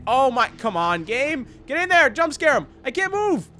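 frustrated gamer. Real, not acted. Frustrated streamer urging the game.

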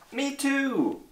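tiny high-pitched squeak of a voice; young kangaroo. A narrator's reading. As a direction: pipe up very high, drawing it out. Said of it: "young kangaroo in her pouch said".

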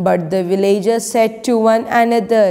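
ordinary talk, nothing marked

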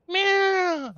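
high, slow drawn-out meow; mock cat meow. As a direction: mew like a frightened cat. Imitates cats in the burning barn.

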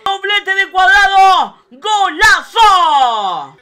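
loud, excited goal call; breakneck goal commentary. Real, not acted.

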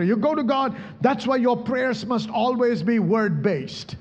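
ordinary delivery

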